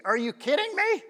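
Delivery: high-pitched mock outrage; indignant disbelief. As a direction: act out incredulous inner griping. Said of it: "This is what's going on in my head".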